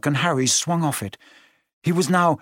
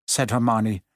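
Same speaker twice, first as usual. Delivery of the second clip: ordinary delivery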